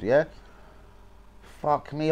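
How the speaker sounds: ordinary delivery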